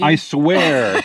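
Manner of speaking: too-quick protest of innocence; defensive, a bit loud; nervous bad liar. Accused of eating the bread.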